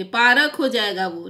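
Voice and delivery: पारक with a full vowel after र, three syllables; the mistake to avoid, पारक said in full; teacher showing the wrong reading पारक. Shown as a demonstration. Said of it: "लेकिन र हम नहीं बोलते".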